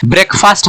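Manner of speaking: "ब्रेकफास्ट" with full "break" and long "faast"; wrong pronunciation. Shown as a demonstration. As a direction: say "ब्रेकफास्ट" as two whole words. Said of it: "ये गलत pronunciation है". ("ब्रेकफास्ट" में लंबा "फ़ास्ट", गलत उच्चारण)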